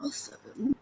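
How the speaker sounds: whispered under the breath. Real, not acted. Quiet aside amid embarrassment.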